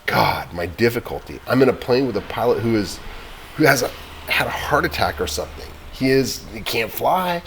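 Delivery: desperate plea. Acted. Boy pleading to the operator for help, desperate. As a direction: beg for help, fear rising.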